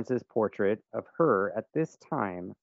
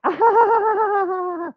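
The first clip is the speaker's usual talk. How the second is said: high delighted squeal; excited shriek; 10 dB louder than usual. Retold or imitated. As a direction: shriek with surprise. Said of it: "And they were like".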